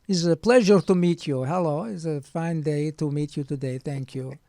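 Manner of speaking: hammed-up Russian doctor accent; Goldfinger impression. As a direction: play the doctor as a Bond villain. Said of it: "He sounds like Goldfinger".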